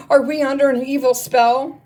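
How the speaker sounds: high, loud frightened question; alarmed cry. Acted. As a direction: cry out fearing a curse. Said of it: "they cried".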